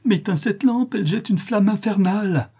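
high-pitched frantic demand; agonised plea. A narrator's reading. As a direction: beg desperately for the lamp out. Notary agonised by light, begging.

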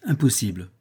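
ordinary delivery, nothing marked

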